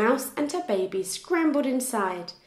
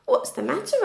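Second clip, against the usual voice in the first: George asking, pitched up and curious; raised questioning voice; 11 semitones higher than usual. A narrator's reading. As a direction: ask kindly with a lifted voice. George asks the animal.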